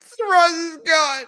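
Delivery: hammed-up fake crying shout. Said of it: "I'm just playing".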